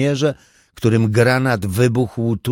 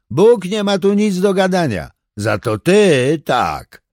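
mocking thug; 9 semitones higher, 4 dB louder than usual. A narrator's reading. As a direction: spit out the scorn, then drag each word. Burglar mocks praying father.

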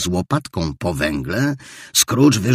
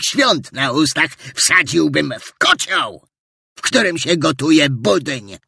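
outraged old miser, louder at the mocked greeting; rising indignation; 7 semitones higher, 3 dB louder than usual. Scrooge venting growing outrage at Christmas revellers. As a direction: boil over at the thought of fools. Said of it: "z wzrastającym oburzeniem".

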